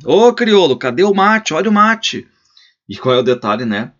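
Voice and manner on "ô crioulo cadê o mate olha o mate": much higher, loud impatient call. Acted. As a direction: yell for the servant. Calling servant into the house.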